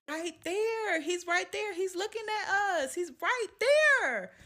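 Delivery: put-on high little-girl voice, slowing at the end; child insisting. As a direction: point and insist. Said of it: "She say".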